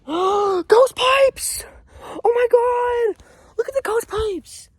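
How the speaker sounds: high, loud, thrilled gasp and shout; excited shout. Real, not acted. Vlogger finally finds ghost pipes, shouting with excitement. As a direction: burst out with delight at the find.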